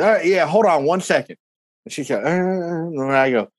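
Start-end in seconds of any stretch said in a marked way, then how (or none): 0.0-1.4 lightly voiced, higher southern clerk; hint of a Southern lady on the phone. Southern lady putting the caller on hold, lightly imitated. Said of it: "this real southern lady picks up".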